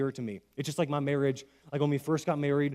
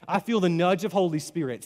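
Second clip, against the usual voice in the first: ordinary talk, nothing marked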